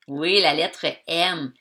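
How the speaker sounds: ordinary delivery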